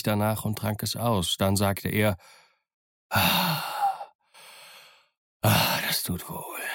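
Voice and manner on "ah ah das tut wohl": breathy, slow sigh of relief; relieved sigh. A narrator's reading. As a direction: let the rum ease you, sighing. Relief after drinking rum.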